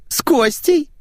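put-on very high squeaky girl voice; shrill squealing woman. Mamzel answers in squeaky voice.

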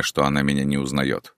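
ordinary delivery, nothing marked

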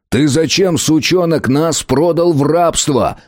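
angry yell. A narrator's reading. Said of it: "заорал Береговой".

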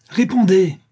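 high, loud angry command; furious cry. A narrator's reading. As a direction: demand an answer in a fury. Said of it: "cria la tante en fureur".

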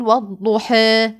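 والضحى with the end halfway between a and e; slow والضحى, final vowel in between; taqlil reading. Shown as a demonstration. Teacher demonstrating Warsh's reduced tilt. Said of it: "التقليل لدى ورش يقول".

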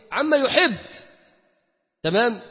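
normal talking voice

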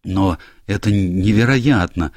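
stumbling over the first word in shock; a flustered stammer. A narrator's reading. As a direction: stutter it out, caught off guard. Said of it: "заикаясь".

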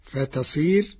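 ordinary talk, nothing marked

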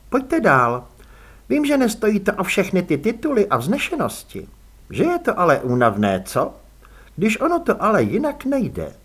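lilting welcome from a lady host; gracious hostess. Acted. Lady greets her titled visitor sir Samuel, lightly gracious.

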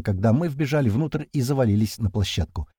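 plain reading voice

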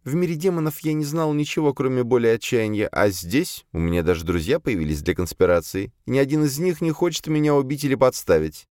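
high, deferential female servant voice; servant demoness explaining. A narrator's reading. Captive demoness justifies herself to her master, high servant voice. Azalia's voice in story.

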